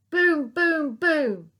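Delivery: loud, high booming knocks; loud knocking sounds. Acted. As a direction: bang it out like knocking. Police knocking.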